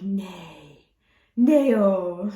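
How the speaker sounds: imitating a stunned Dutch exclamation, building loud; amazed disbelief. Retold disbelief at waiter having boat.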